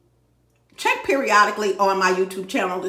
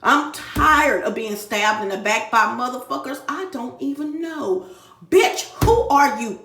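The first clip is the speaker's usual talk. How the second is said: loud, high, raging tirade; real furious rant; 3 semitones higher, 4 dB louder than usual. Real, not acted. Fed-up woman ranting to her viewers, furious and loud. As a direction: let the anger boil over, louder and louder. Venting anger at stalkers.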